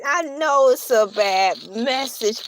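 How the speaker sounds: usual speaking voice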